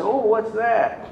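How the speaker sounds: high, slow, faux-innocent question; mock innocent. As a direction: play dumb and curious. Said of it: "I said".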